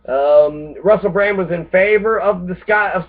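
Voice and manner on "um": usual speaking voice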